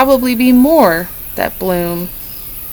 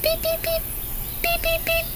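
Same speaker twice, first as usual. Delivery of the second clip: very high, soft chick peeps; chick peeping; 13 dB quieter than usual. Shown as a demonstration. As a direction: peep like a tiny baby bird. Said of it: "Do you know how to peep like a chick?".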